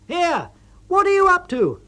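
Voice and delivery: high, loud exasperated call across the tent; loud, high, irritated shout; angry call. A narrator's reading. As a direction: yell across at your clumsy partner. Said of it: "you call out".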